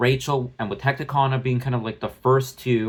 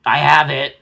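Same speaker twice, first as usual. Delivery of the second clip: snapping back loudly at the phone; louder, indignant comeback; talking back to a nagging phone, loud; loud retort; 9 dB louder, much slower than usual. Real, not acted. Retorting to phone storage warning.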